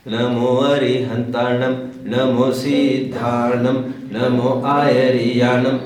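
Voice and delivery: slow, monotone mantra recitation, word by word; prose-style recitation. Shown as a demonstration. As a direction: demonstrate the faulty droning way of reciting. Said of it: "इस प्रकार से बोलने का एक trend". (धीमे, एक सुर में गद्य जैसा मंत्र-पाठ)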